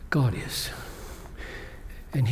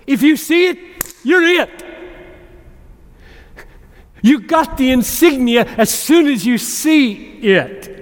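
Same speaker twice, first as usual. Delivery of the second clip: higher and louder, ringing out to the congregation; full-force preaching; 9 semitones higher, 6 dB louder than usual. Real, not acted. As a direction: proclaim it like a rallying cry.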